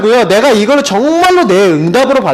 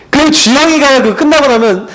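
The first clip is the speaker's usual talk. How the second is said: normal talking voice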